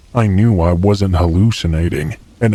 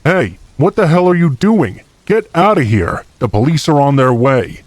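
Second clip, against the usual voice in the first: high, urgent yell, held back in volume; boyfriend yelling out; 7 semitones higher than usual. A narrator's reading. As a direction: call out a warning to scare someone off. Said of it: "I heard my boyfriend yelling out".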